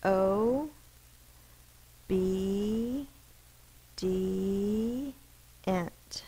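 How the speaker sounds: sounding out obedient syllable by syllable. Shown as a demonstration. Teacher to students, sounding out syllables slowly. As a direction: break "O, B, D, ent" into slow separate sounds. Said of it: "the first base word is obedient".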